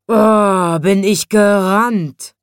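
loud, slow, drawn-out sigh. A narrator's reading. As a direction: sigh it out as the exhausted waking lion. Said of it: "seufzte der goldene Löwe". (langgezogener, lauter Seufzer)

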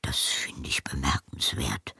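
low murmured grumble, half whispered; grumbling. A narrator's reading. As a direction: grumble it under your breath. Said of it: "brummte er".

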